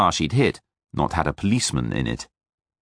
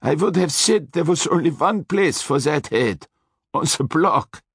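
menacing Austrian driver, high; threatening Austrian voice; Austrian accent; 8 semitones higher than usual. A narrator's reading. Hector continues, grim.

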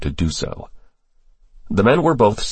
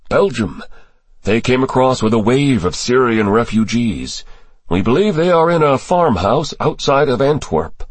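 higher-pitched character voice for testimony; briefing official; 6 semitones higher than usual. Acted. Official briefing senators on terrorist ties, higher character voice. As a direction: lay out the intelligence in a raised register.

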